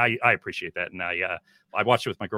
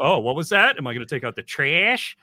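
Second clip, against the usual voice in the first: put-on teasing, higher Wisconsin accent parroted back; mock Wisconsin accent; 5 semitones higher, 4 dB louder than usual. Boyfriend teasing girlfriend endlessly, exaggerated Wisconsin accent. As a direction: exaggerate the Wisconsin TRASH as a question. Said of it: "The accent comes out".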